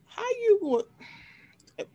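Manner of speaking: high, drawn-out disbelieving cry; mock disbelief. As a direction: stretch it out in a high, incredulous voice. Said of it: "I'm like".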